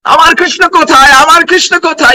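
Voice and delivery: high, lightly voiced lament; mock wail, searching for Krishna; imitating crying out. Nityananda searching for his Krishna, high mock wail. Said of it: "crying loudly".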